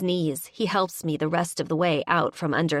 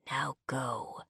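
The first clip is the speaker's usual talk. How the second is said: much softer, low whisper; 4 semitones lower, 9 dB quieter than usual. Acted. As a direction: breathe it against her lips. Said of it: "he whispers against my lips".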